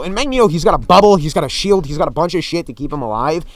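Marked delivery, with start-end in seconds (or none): none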